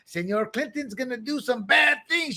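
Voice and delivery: high-pitched fake Dutch accent, sounding Spanish; mock Dutch accent. Dutch intelligence passing on a warning, in a mock Dutch accent. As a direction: ham up a bad Dutch accent. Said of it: "That's my Dutch accent".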